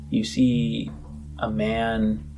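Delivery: ordinary talk, nothing marked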